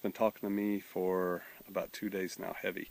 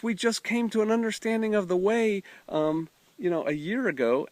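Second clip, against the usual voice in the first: much higher, friendly mock greeting; young woman's voice; 9 semitones higher, 5 dB louder than usual. Young woman greeting the pastor, imitated high and friendly. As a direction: lightly imitate a cheerful college girl.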